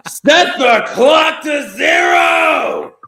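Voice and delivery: slow, high, loud shout. Real, not acted. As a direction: bellow it out slowly. Celebratory call.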